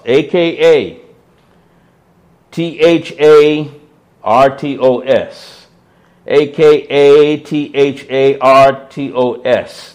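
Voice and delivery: each letter of akarthetos given slowly and separately; spelled out. Shown as a demonstration. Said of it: "spelling akarthetos".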